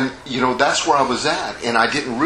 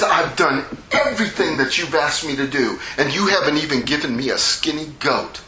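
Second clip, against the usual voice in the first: higher-pitched, resentful complaint; ticked-off son; 4 semitones higher than usual. Acted. Older son complains he was never rewarded, ticked off. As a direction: list your grievance to your father, worked up. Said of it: "I believe he was ticked".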